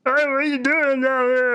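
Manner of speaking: very high Wookiee wail with words in it; Chewbacca. Retold or imitated. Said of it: "Chewbacca voice, but he's saying words".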